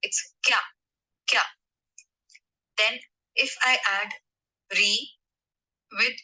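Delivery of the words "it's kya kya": kya as one syllable, k glides straight into ya. Shown as a demonstration. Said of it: "it will be called kya and not kiya".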